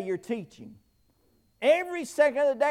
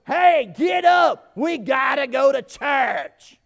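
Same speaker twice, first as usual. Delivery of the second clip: put-on shouting at kids; 5 dB louder, slower than usual. Dad yelling at sleeping kids to get up for church, loud and slow. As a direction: yell the kids out of bed. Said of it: "and I go".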